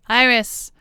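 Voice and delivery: loud, high call of a name; sharp call. Acted. Calling Iris to account, loud sharp call. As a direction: snap her name out to stop her.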